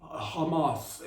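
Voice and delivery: put-on slow, heavy foreign accent on Hamas; hammed-up accent, drawn out. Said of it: "Oh, the accent".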